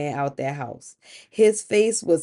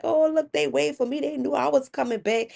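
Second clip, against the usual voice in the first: higher-pitched, self-satisfied delight; pleased with himself; 7 semitones higher than usual. Acted. Returning husband sees the waiting line, pleased with himself. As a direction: lift it up, flattered by the crowd. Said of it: "he was feeling good about himself".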